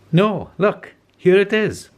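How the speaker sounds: put-on pirate girl crying out in triumph; excited cry. A narrator's reading. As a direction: call out the find with delight. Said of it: "cried Peg Polka Dot".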